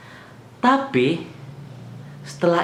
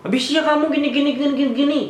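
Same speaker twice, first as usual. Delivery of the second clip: much higher mocking imitation of the officer; mock cop; 12 semitones higher, faster than usual. Driver mocking the officer's bullying, squeaky parody voice. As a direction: parody his nagging in a squeaky voice.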